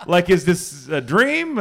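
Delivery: high, loud, hammed-up giddy disbelief; comic starstruck outburst; incredulous excitement. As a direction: pile up the questions like an overwhelmed fan. Acted giddy reaction to the offer.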